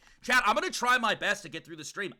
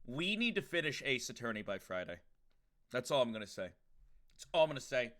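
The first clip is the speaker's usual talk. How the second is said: ordinary talk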